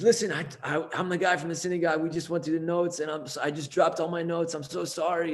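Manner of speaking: high, hurried, flustered apology; acted stressed out. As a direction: act out a frantic apology. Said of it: "he's, like, all stressed out".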